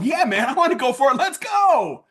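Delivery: fired-up, high-pitched buyer; pumped yes, climbing high on "go"; eager customer. Acted. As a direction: jump at the offer with rising excitement. Acts out eager customer Nick.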